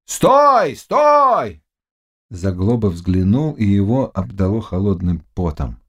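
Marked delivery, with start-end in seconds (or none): 0.0-1.6 high, long hailing shout; drawn-out commanding yell. A narrator's reading. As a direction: holler across the water. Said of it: "закричали плывшим на пароме".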